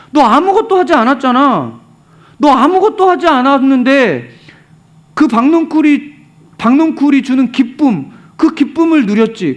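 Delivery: high-pitched, insistent acted scolding; indignant reproach, pitch held high; God rebuking. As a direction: tell him off point by point in exasperation. Acts God rebuking Jonah.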